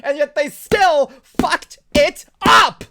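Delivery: slow, loud, exasperated yell; building to a shout, each word punched; real anger. Real, not acted. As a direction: rant that erupts on the last words. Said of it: "I am getting legitimately angry tonight".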